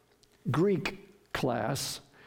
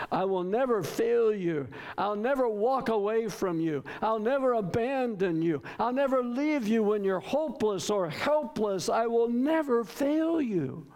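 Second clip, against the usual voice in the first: NEVER hit in each phrase, pitched up; emphatic promise; 5 semitones higher than usual. Acted. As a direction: build vow upon vow, stressing every NEVER. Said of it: "That's what he's emphasizing".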